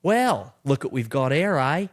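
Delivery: put-on loud, high Australian drag-film accent. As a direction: quote the bar lady in broad Australian. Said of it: "And the lady goes".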